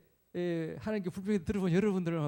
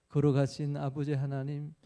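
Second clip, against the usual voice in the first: imitated church prayer, low and measured; mock-solemn worship-leader intonation; formal public prayer; 5 semitones lower, 4 dB louder than usual. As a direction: recite a rote congregational prayer in a low tone. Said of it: "그런 기도는 잘했어요".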